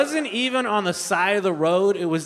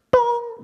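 high, loud "boom" chime; imitated intercom ping; 5 dB louder than usual. Comic mimics the ping calling her at work, high paging chime. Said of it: "just to get pinged at work".

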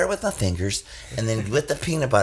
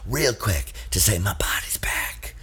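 slow whispered aside; 4 semitones lower, 5 dB quieter than usual. Acted. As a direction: breathe it out secretly.

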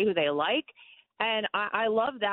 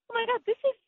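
retold delighted surprise; 7 semitones higher than usual. Guest tells host she recognized his mom's house, retold delighted surprise. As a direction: replay a thrilled realization, pitch leaping up. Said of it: "And then I'm like".

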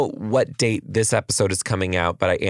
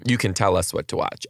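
normal talking voice

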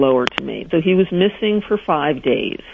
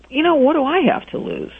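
higher, quick what-the-heck thought; desperate shrug; 10 semitones higher, faster than usual. Retold or imitated. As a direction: talk yourself into it.

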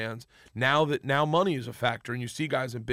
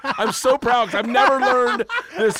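giddy excitement; 11 semitones higher, 10 dB louder than usual. Retold or imitated. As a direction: laugh it out, thrilled to be learning. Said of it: "I got so excited".

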